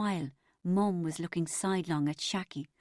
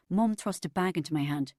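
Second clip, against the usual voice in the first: plain reading voice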